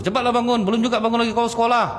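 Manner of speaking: high, hurried nagging mother. Retold or imitated. Mother nagging her child to wake for school. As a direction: hurry the sleepy child out of bed.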